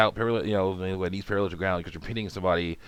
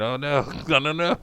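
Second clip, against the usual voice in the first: laughing dismay; 6 semitones higher, 5 dB louder than usual. Real, not acted. Realising he does Southern.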